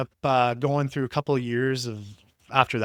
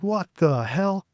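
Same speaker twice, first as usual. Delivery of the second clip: stunned what the hell; 5 semitones higher, slower than usual. Retold or imitated. Standing naked before her mom.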